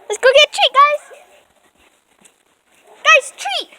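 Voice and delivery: high dog-calling voice; talking to a dog. Real, not acted. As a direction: coax the dogs in. Calling dogs for a treat.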